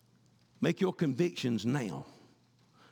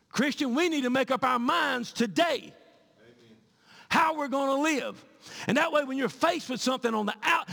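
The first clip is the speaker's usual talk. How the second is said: loud, high sermon at full force; fiery preaching; 9 semitones higher, 4 dB louder than usual. Acted. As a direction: preach it hard to the congregation. Preaching again.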